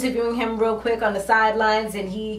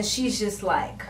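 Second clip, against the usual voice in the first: ordinary talk, nothing marked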